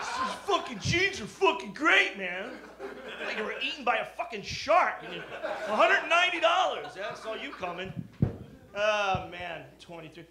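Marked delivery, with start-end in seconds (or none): 0.0-7.9 sarcastic hammed-up buyer; mocking hipster. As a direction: ham up a sucker bragging. Mocking jeans buyer and seller.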